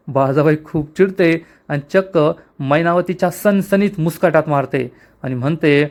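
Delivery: ordinary delivery, nothing marked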